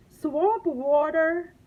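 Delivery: loud, slow, shocked yell. A narrator's reading. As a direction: shout it back in disbelief. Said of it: "yelled the waiter".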